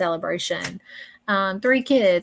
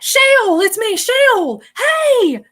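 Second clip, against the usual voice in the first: loud, much higher gleeful calls; excited calling out; 12 semitones higher, 9 dB louder, much slower than usual. Retold or imitated. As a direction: call out her name like a happy friend bursting in. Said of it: "Just like that".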